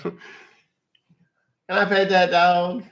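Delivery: high eager question rising on I; trend voice again. Retold or imitated. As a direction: beg to pet the dog, giddy. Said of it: "You know what I'm talking about?".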